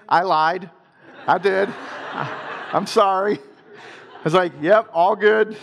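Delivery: chuckling through the confession; laughing. Real, not acted. Confessing to the audience he lied to his wife, laughing. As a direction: laugh while owning up to the fib.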